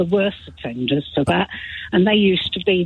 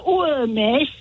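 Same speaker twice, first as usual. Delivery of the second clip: higher, drawn-out Nottingham schoolchild voice; Nottingham pupils' reply; 6 semitones higher than usual. Retold or imitated. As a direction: imitate pupils answering in Nottingham accent. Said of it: "they'd go".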